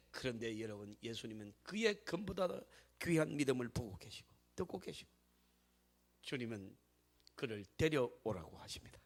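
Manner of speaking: low, quiet sermon voice with weighty pauses; hushed preaching. Real, not acted. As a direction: drop low and soft, almost confiding. Hushed preaching after the climax. (낮고 조용하게 설교)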